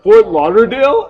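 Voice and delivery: imitated incredulous teasing; mock disbelief. Retold teasing argument with the girl.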